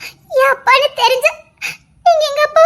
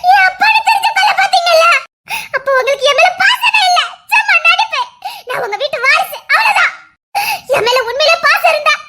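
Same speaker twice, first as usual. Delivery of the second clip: crying accusation. Acted. Daughter accusing her own father, shouting through sobs. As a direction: wail it through tears at him.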